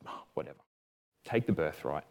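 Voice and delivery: very soft, low, dismissive mumble; careless giving in. Acted. Esau gives up his birthright to Jacob, quietly dismissive. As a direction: toss it away under the breath.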